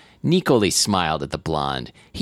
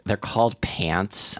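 put-on 1 snippy older sister; 3 dB quieter, slower than usual. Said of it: "his older sister said".

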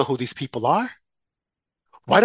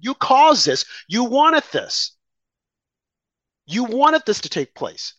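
accusing; 7 semitones higher, slower than usual. Real, not acted. Scolding liberals directly.

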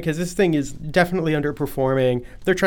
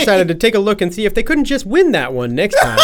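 louder, higher mock news read; mock news anchor; 6 semitones higher, 6 dB louder than usual. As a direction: deliver it like breaking news.

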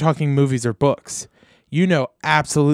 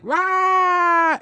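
short high-pitched mock "Rah!" scream. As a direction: yelp it like the Scream painting. Mimics screaming face in picture.

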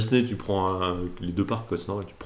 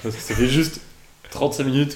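laughing; 4 semitones higher, 3 dB louder than usual. Real, not acted. Teasing friend, laughing.

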